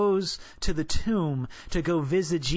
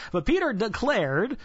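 ordinary talk

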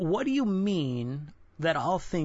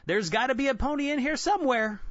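bright, much higher cheery voice; optimist twin; 9 semitones higher, 3 dB louder, faster than usual. Retold or imitated. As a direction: play the eager optimist brother. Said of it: "his optimist twin replied".

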